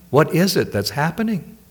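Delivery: ordinary talk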